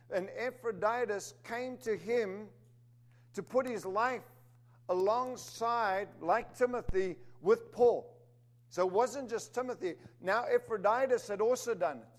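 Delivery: firm, rising sermon delivery; preaching. Acted. Preacher calling the church to collective strength, firm. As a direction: press the point home to the congregation.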